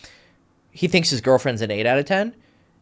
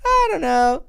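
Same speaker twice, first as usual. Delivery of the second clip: much higher, louder dreamy girl impression; mock girly voice; 5 dB louder than usual. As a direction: play an undecided girl, high and sing-song. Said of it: "that's how girls, like, think".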